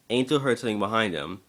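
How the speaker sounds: ordinary delivery, nothing marked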